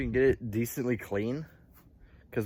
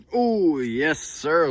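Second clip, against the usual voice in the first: higher, louder delight; excited; 4 semitones higher, 5 dB louder, much slower than usual. Real, not acted. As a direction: cheer at the new tires. Showing off new tires.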